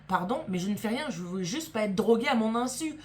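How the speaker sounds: usual speaking voice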